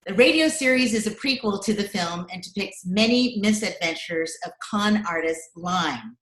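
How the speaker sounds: ordinary talk, nothing marked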